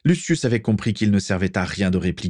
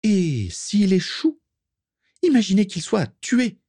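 very high, alarmed-sounding woman's voice; Prisca; 13 semitones higher than usual. A narrator's reading. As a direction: press the objection in a perched voice. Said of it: "de sa voix haut perchée". (voix haut perchée qui insiste)

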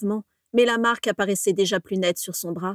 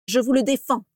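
cold forbidding; 4 semitones higher, 4 dB louder than usual. A narrator's reading. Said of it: "protesta froidement Abraxas".

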